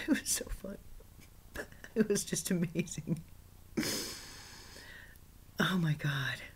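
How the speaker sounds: giggling whisper; laughing. Real, not acted. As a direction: laugh softly in delight.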